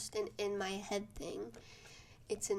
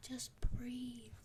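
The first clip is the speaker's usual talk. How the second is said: soft calming whisper; 2 semitones higher, 5 dB quieter than usual. Retold or imitated. Boyfriend calming her on phone.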